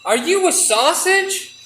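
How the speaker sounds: high, slow mouse voice; a little mouse peering up in surprise; squeaky mouse. Acted. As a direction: mouse asks with wide-eyed disbelief. Mouse voice in the skit.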